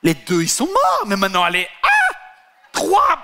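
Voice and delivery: scandalized shout. Acted.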